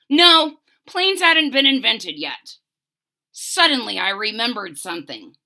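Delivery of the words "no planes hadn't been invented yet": grandpa's reply. Acted. Grandpa answers firmly.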